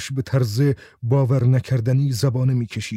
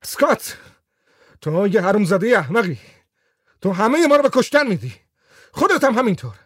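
shouting; 8 semitones higher, faster than usual. A narrator's reading. Raco yelling at the man holding the lighter, high panicked shout. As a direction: scream it at him in panic. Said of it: "راکو فریاد زد".

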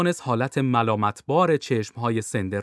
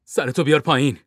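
louder, firm order; commanding; 6 dB louder than usual. A narrator's reading. Bond ordering the captain to duck, commanding. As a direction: order it with authority. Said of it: "باند با تحکم گفت".

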